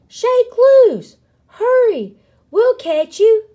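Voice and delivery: very high, loud urging; shouting. A narrator's reading. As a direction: shout urgent instructions upward. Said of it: "the prairie dog shouted".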